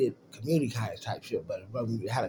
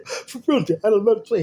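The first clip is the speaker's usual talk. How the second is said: higher, louder tearful protest; imitating a crying son; crying kid voice; 9 semitones higher, 8 dB louder than usual. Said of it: "He starts crying".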